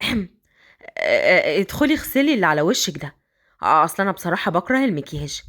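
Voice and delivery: put-on a man coaxing the girl upstairs.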